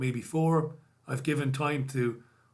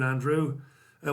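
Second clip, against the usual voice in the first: normal talking voice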